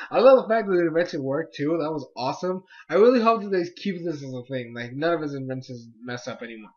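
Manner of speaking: ordinary talk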